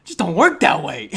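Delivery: loud, high list, item by item; outraged rant. Real, not acted. Podcaster incredulous at two-team Rams fan, outraged rant. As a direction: pile up each item in comic outrage.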